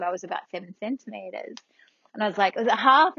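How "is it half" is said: higher, louder rising question; alarmed questioning. Retold or imitated. Patient quizzing partner on progress, alarmed questioning. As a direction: press for the answer in disbelief.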